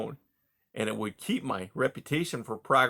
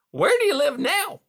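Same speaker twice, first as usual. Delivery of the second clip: much higher, louder hearty voice; hearty beaver; 7 dB louder than usual. A narrator's reading. Beaver Mister Conrad greeting caged Rye, high and hearty. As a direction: boom out a jovial salesman beaver.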